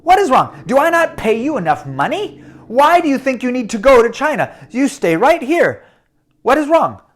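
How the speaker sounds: an impatient master scolding his servant. Acted. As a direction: press him hard for an answer. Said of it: "was impatient".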